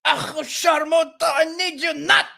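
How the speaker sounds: high, slow mock cussing in Arabic; a coach cursing out his player in Arabic, hammed up and laughing. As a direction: ham up a furious coach swearing in Arabic, laughing through it. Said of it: "he starts cussing in Arabic".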